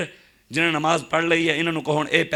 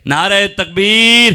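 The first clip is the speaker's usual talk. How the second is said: very loud, long-drawn rallying cry; real slogan shout; 3 semitones higher, 12 dB louder, much slower than usual. Real, not acted. Preacher calling on the congregation to answer his slogan, drawn-out shout. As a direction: bellow it out to rouse the crowd, stretching the last word. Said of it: "نعرہ لانا ہے وال کر کے".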